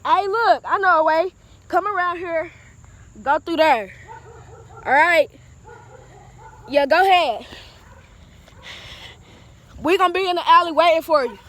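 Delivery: loud call to a friend up the alley. Real, not acted. Calling to friend far ahead.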